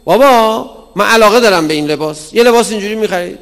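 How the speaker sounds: put-on high, loud pleading child's voice; whiny child. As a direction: whine at dad for the clothes. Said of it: "نگو".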